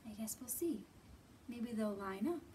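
normal talking voice

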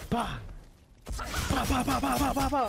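high, mimicked popping bursts of bah; mock firework bangs. Imitating fireworks noise.

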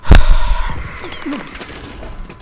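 long, high, airy exasperated sigh; a big fed-up sigh. Real, not acted. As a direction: let out all the frustration in one breath. Pissed off at lag, ranting.

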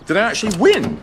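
can't believe his luck, voice leaping up; amazed delight. Acted. Martin thrilled to win a coin toss, amazed delight.

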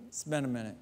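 soft, low, embarrassed mumble; sheepish son. Retold or imitated. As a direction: admit it quietly, caught out. Son answering father.

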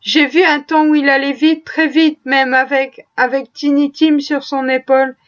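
usual speaking voice

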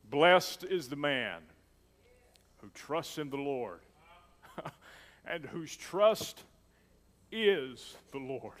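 slow, loud, weighty scripture read; solemn proclaiming of scripture. Acted. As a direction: declaim the verse slowly like a preacher. Reads scripture emphatically.

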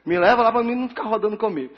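fast, high, loud instruction; hurried, emphatic order to the driver; urgent request. Retold or imitated. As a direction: rush it out, trying to sound sure. Telling taxi driver firmly.

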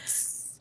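soft hiss of a sizzle; imitated sizzle. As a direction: hiss like something burning. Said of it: "I heard".

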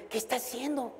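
soft puzzled under-the-breath question; guard muttering about the professor's kindness; baffled aside. Retold or imitated. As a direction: mutter it from the back seat, baffled. Guard muttering from back seat.

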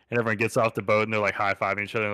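ordinary talk, nothing marked

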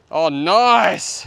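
ordinary talk

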